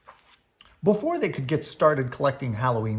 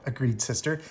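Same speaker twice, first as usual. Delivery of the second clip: plain reading voice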